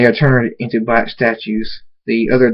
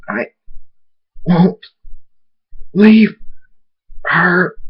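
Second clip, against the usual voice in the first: word by word, forced out higher; straining defiance; 7 semitones higher, much slower than usual. A narrator's reading. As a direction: push each word out against great force. Struggles while being hit.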